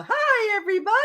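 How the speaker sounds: put-on high, sing-out child greeting; little girl's voice. Imitating the child heard over the walkie-talkie, high girlish voice. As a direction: chirp it like a small girl. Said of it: "hear this little girl's voice go".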